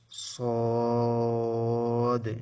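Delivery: সদ held long, full and heavy; drawn-out sad. Shown as a demonstration. Teacher showing students the heavy letter সদ. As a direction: stretch সদ with a thick s sound. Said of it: "একটু লক্ষ্য করুন".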